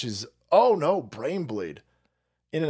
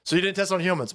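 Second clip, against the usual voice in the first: ordinary delivery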